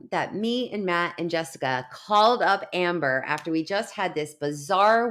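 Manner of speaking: ordinary talk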